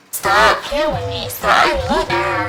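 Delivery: slow, loud, pressed warning; drawn-out loud telling-off; stern warning. Retold or imitated. Confronting coworker, retold.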